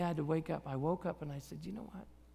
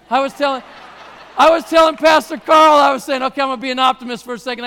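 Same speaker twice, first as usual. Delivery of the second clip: excited loud retelling; 4 semitones higher, 13 dB louder, faster than usual. Real, not acted.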